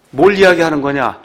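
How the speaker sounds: normal talking voice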